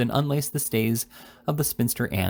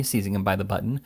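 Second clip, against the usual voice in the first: usual speaking voice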